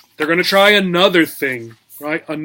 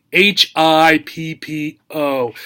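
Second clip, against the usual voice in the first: spelling out; slower than usual. Shown as a demonstration. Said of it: "spells the word".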